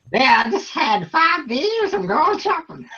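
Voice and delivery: hammed-up drunk Aussie shopper. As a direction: play a tipsy bloke heading to the shop. Drunk shopper quote, mock voice.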